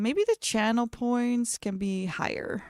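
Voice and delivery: ordinary delivery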